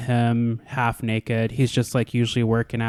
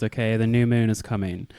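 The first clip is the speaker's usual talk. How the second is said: normal talking voice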